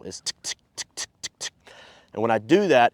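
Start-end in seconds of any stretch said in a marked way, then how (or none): 0.2-1.5 tick, tick said as unvoiced tongue clicks; whispered tick sounds. Shown as a demonstration. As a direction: clip each tick short and breathy. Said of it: "that's the sound that I'm making".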